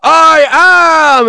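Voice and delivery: high loud shouted I AM; mock metal frontman shout; singer hyping crowd. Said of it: "so he goes".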